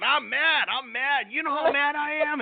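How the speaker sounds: high mock whine of an angry person. Mocking people who jump on the wagon, high whiny mock. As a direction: ham up a petty grumble.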